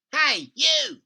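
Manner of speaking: high, loud bossy yell; grandma yelling for attention. Acted. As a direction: bawl at them from the roof. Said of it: "she yelled".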